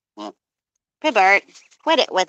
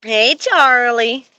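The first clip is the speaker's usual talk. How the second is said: loud, higher, drawn-out call; slow, raised-voice hail; 4 semitones higher, 6 dB louder, much slower than usual. Real, not acted. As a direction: call a misbehaving pet to attention. Calling out to misbehaving animal.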